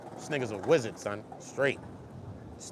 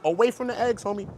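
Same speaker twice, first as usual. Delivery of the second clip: ordinary talk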